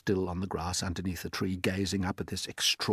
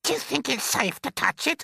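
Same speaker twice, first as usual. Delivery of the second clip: a far higher, louder aunt's shout; Aunt Spiker shouting; 4 dB louder than usual. Acted. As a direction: screech it out as the nasty aunt.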